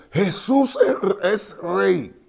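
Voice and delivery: slow, high, loud call; shouted from the crowd. Retold or imitated. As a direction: cry it out over the crowd. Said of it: "uno gritó".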